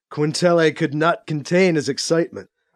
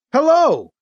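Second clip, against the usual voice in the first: calling over the comm; 11 semitones higher, 6 dB louder than usual. A narrator's reading. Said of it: "Alibi's voice called over the comm".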